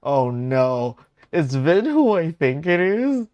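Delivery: loud, rising high disbelief; dismayed realization. Real, not acted. Streamer realizing who Vin resembles, loud high disbelief. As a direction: build from loud dread to a high squeal.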